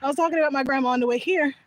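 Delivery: normal talking voice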